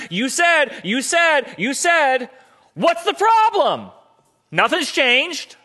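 high, loud, exasperated pleading; exasperated wife. Acted. Frustrated wife confronting husband who dropped the rock, loud and high.

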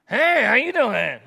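hammed-up slow flirt; mock pickup line. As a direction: lay on a cheesy come-on. Acted pickup line after rejection.

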